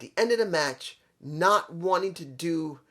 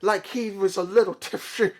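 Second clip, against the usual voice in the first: lightly slurred tipsy voice; a hint of drunken slur; tipsy imitation. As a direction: mimic a slightly drunk man. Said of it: "talking like this".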